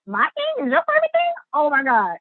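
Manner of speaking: high-pitched, incredulous squeal of delight; retold amazement. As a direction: react in starstruck disbelief. Retold amazement at star's praise.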